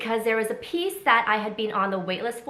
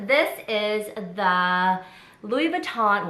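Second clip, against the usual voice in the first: normal talking voice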